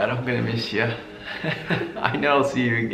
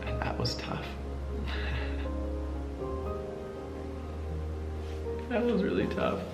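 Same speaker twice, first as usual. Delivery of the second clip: choked up, barely holding it together; tearful; 11 semitones higher, 12 dB quieter than usual. Real, not acted. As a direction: voice breaking into tears. Son mourning father, breaking down.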